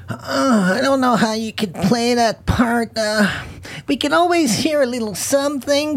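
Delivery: high-pitched English-speaking producer imitation; producer's condescending remark. Producer doubting the actress over her accent, high mock voice.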